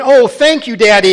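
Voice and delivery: put-on high, loud, delighted little boy; a thrilled little son thanking his daddy; beaming child. A narrator's reading. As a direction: burst out with a child's joy.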